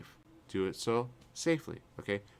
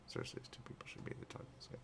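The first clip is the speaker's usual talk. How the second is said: whisper; 16 dB quieter, faster than usual. Real, not acted. Whispered aside.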